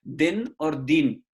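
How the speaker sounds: दिन short and दीन with a long ई, said slowly; vowel length contrast. Shown as a demonstration. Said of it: "दीन और दीन में फर्क क्या है". (ह्रस्व दिन और दीर्घ दीन, धीरे से)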